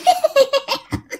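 very high, thin staccato cackle; imitated ghostly cackle. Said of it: "nó vừa ăn mà nó vừa cười".

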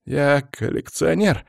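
high, slow, halting nervous voice; jittery man introducing himself. A narrator's reading. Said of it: "нервно сглотнул несчастный".

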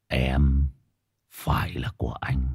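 low unvoiced threatening whisper; villain whisper. Shown as a demonstration. Villain role demo.

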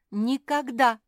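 very loud, high outburst; stamping, emphatic protest; indignant refusal. A narrator's reading. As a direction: refuse flatly at full volume.

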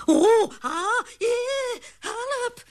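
high, panicked shrieks for help; frightened cry. A narrator's reading. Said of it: "riep ze".

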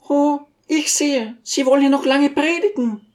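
high lady's voice, restrained anger; angry Besjukina. A narrator's reading. Lady loses patience with the teacher, angry high voice. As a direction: cut off his sermon, pitched very high. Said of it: "rief die Besjukina in wilden Zorn".

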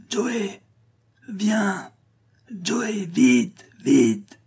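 high, muffled call from far off; distant muffled voice. A narrator's reading. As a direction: call out faint and far away. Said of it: "Une voix lointaine, étouffée, méconnaissable".

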